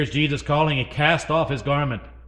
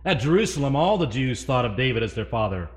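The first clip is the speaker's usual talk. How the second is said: ordinary delivery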